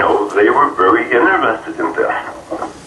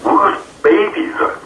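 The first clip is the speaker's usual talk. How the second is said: high, slow, retold disbelief; astonished; 11 semitones higher than usual. Abductee looking around room full of babies, astonished. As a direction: draw the question out in wonder.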